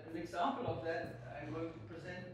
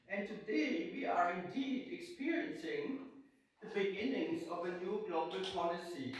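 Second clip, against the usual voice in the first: formal paper reading; 4 dB louder than usual. Acted. Reads her paper aloud.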